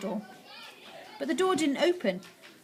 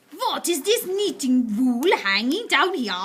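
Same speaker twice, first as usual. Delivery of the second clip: slow, higher witch voice; suspicious witch; 4 semitones higher, 6 dB louder, slower than usual. A narrator's reading. Witch finding the wool, slow and suspicious. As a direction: probe it with cold suspicion.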